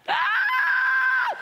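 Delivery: piercing high scream, very loud; sudden scream. Acted. Preacher acting out how drowning people cry out, piercing scream. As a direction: let out a sudden panicked scream.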